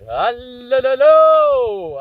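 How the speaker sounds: high, very loud crowd call; rock star shouting. Pretend. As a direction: call out to a packed stadium. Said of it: "you can shout all you want".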